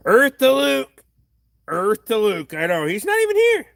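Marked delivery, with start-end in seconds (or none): 0.1-1.0 louder, higher call to Luke. Pretend. 2.9-3.7 indignant. Real, not acted.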